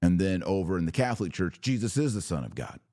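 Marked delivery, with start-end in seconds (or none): none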